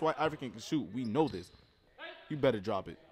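ordinary talk, nothing marked

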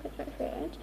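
rushed hushed whisper; whispered hurry-up; urgent whisper. Real, not acted. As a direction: whisper it fast and tense.

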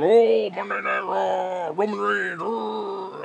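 slow, hammed-up gushing boss pitch; mocking Vince. Ranter mocks Vince pitching his show to investors, hammed-up gushing. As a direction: ham up a clueless promoter selling his show. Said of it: "Talking about".

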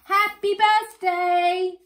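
high, loud, drawn-out call. A narrator's reading. Children call to the dragon from the path, high loud slow call. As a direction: sing it out slowly to someone up the path. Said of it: "called a voice".

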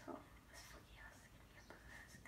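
scared whispered aside; whispering. Real, not acted. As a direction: whisper it under your breath. Scared aside during Ouija session.

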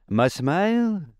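a quaint pumpkin-headed figure speaking; lightly sung-out pumpkin man; Jack Pumpkinhead. A narrator's reading.

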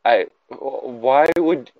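ordinary talk, nothing marked

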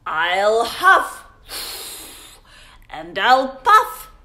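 loud, slow growled wolf threat; booming storybook wolf; growling wolf. Acted. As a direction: growl it out like the big bad wolf. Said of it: "growled the wolf".

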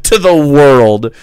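mocking echo. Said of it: "repeats host's phrase".

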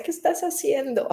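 high teasing mock question. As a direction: tease him for praying, lightly. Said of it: "me burlaba de él".

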